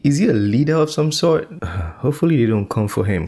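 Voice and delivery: ordinary talk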